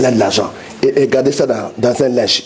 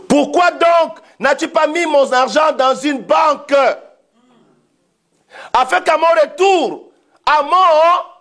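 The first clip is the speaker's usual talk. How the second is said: fervent preaching; 3 semitones higher than usual. Real, not acted. Preacher urging believers to account for their talents, fervent exhortation. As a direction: preach it with long, ringing phrases.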